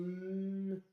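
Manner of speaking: "m" fredonné et tenu, sans voyelle; son M isolé. Shown as a demonstration. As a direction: faire durer le son "m". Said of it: "on avait aussi eu le son". (le son « m » prolongé, lèvres fermées)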